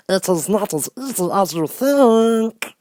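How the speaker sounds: high, slow mocking creature voice; gloating Hammerhead. Hammerhead taunting the fallen Scarecrow, slow gloating. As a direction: taunt from the hilltop. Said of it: "laughed harshly and said".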